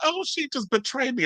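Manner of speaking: high, breathy put-on victim voice; mocking the housewives' fake hurt; mock wounded whine. Vlogger mocking housewives playing the victim, high and whiny. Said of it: "trying to act like".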